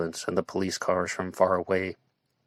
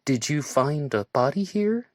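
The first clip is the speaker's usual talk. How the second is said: high, slow, bright-pitched question; chirping; 7 semitones higher than usual. A narrator's reading. Joanna asking the officer about a body, chirping. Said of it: "in her chirping way".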